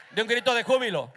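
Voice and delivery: loud, rousing order; shouted call. Real, not acted. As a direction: shout it out to the congregation. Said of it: "no está acostumbrado a dar gritos". (grito de júbilo a todo pulmón)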